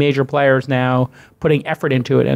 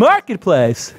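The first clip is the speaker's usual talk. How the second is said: big smile; 4 semitones higher, much slower than usual. Retold or imitated. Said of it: "say a big smile when you say Marketplace".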